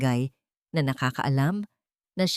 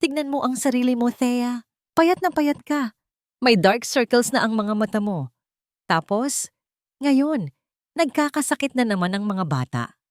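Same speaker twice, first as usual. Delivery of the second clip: put-on higher, fast scolding old woman; grumbling grandmother; 7 semitones higher than usual. A narrator's reading. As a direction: nag her about how thin she looks. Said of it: "Umungol si Lola Ising".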